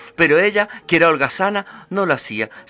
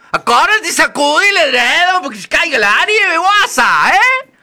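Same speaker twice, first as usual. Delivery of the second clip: high, loud bossy voice; Madre Nieve's reminder; 13 semitones higher, 8 dB louder than usual. Acted. Madre Nieve reminds the girl to shake the quilt, high and loud. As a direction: nag her cheekily.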